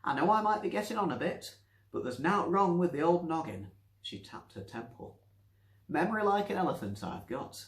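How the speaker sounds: put-on high, loud boastful old woman; proud old Nancy. Nancy boasting to the doctor. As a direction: boast about her memory.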